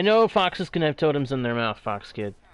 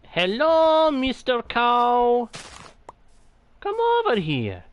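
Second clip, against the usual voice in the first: medic voice; 7 semitones higher than usual. Acted. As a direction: coax the cow like a cheery medic. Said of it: "Channeling my inner medic voice".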